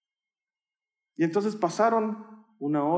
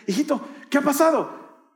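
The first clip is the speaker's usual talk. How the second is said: higher-pitched, acted motherly call; 9 semitones higher than usual. Mother calling her son from the bathroom door, concerned calling. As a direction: call to your child through a door. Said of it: "empezó a llamarme desde la puerta".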